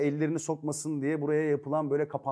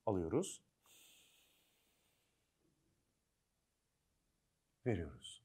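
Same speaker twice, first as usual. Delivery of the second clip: soft spa whisper; 5 semitones lower, 9 dB quieter than usual. Acted. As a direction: lead a calm breathing exercise softly. Mock meditation opening. (yumuşak, sakin meditasyon sesi)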